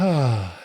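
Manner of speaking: long, falling sigh out; audible exhale. Shown as a demonstration. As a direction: let the breath go in an easy sighed "ah". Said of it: "kind of breathe out".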